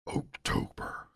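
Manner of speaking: whispered ghoul voice; horror host. Acted. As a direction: hiss it like a Halloween ghoul. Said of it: "a ghoul vo-, voice or something for Halloween".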